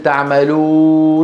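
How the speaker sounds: تعملوا ending in a long level oo; same sound as madd. Shown as a demonstration. Teacher asks whether it matches the leen sound, sustained madd. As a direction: hold the final oo of تعملوا steadily. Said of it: "نفس الصوت".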